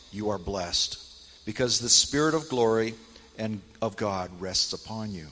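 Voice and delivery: normal talking voice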